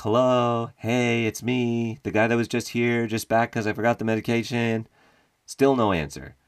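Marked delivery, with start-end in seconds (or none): 0.0-4.9 high apologetic calling voice; sheepish intruder call-out. Retold or imitated. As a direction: announce yourself awkwardly so nobody panics. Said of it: "doing that thing where I'm being like".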